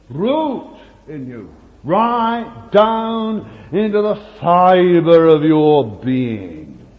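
preacher urging the congregation to grow roots; preaching. Real, not acted. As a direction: drive it home from the pulpit. Preaching climax.

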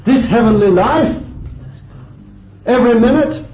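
high, loud, ringing challenge to the congregation; impassioned preaching. Real, not acted. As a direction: preach it at full force, pitched right up. Preaches at full force.

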